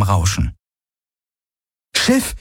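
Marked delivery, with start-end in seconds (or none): 2.0-2.4 high-pitched urgent call. A narrator's reading. Kirai hails the silent ship, calling urgently. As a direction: call into the comm, voice leaping up. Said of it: "rief Kirai in das Komm".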